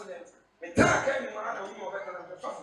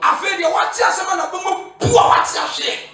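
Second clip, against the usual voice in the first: loud, higher preaching; fiery sermon shout; 9 semitones higher, 10 dB louder, slower than usual. Acted. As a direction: drive the point home to the church.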